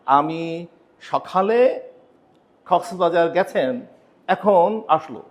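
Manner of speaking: foreigner's broken Bangla. Retold or imitated. Mimicking a foreigner speaking Bangla, very slow and halting. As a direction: stumble through Bangla like a visiting foreigner. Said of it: "আরেকজন বিদেশি এসে যখন আপনার সঙ্গে কথা বলবে".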